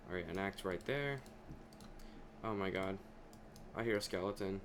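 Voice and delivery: ordinary talk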